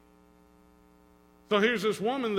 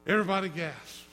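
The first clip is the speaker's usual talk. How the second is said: normal talking voice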